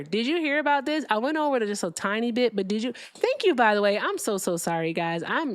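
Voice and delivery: usual speaking voice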